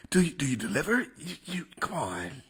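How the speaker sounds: halting, stammering politician caricature; Joe Biden. Acted. Comedian as the president asking about delivery, Biden impression. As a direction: falter over the question, then draw out the punchline. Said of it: "who the fuck is a Joe Biden?".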